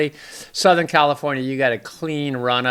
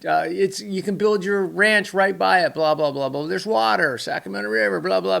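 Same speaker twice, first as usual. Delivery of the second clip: higher, fast hammed-up sales spiel; salesman pitch; 7 semitones higher than usual. Vlogger mocking a real estate salesman luring easterners, hammed-up pitch. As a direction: sell the land like an old-time huckster. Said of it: "it was a salesman who was selling real estate".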